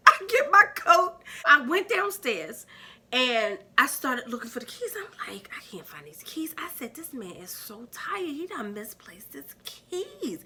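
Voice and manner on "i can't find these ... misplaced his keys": whispered worry. Retold or imitated. Searching downstairs for his keys.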